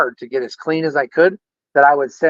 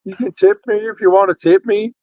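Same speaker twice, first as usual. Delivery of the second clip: Jake Hudson imitation; 5 semitones higher than usual. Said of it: "a Jake Hudson imitation".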